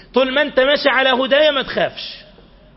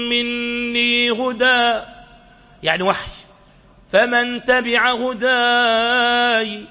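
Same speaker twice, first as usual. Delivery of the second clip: slow, melodic chanted recitation; Quran recitation; much slower than usual. Acted. Preacher reciting the verse to the congregation, slow and sung.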